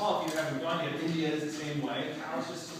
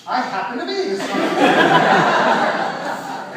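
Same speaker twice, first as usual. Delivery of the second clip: higher, much louder and very slow, with a laugh; mock boast; 8 semitones higher, 14 dB louder, much slower than usual. Telling tiny kids he is the strongest man, hammed-up boast. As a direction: ham up a grand proclamation.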